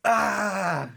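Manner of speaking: loud, high mock scream of pain; imitated scream. Storyteller imitating the friend landing from the truck, screaming. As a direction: cry out like someone hurt on landing. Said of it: "He starts screaming".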